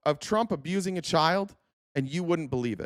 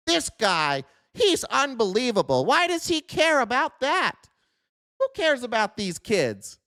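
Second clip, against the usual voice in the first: higher, sneering imitation of a troll in chat; mocking viewer voice; 6 semitones higher than usual. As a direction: jeer at yourself as the viewer would. Mocks viewer again, sarcastic.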